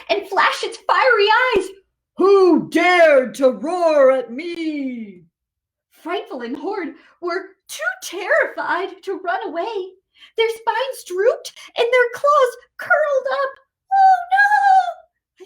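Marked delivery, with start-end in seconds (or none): 2.2-5.2 slow, booming giant monster; slow menacing giant's boom; big monster voice. Acted. Big monster angrily confronts. 13.9-15.5 high, loud frightened cry; high hammed-up scared yelp; mock terror. Terrified little monsters.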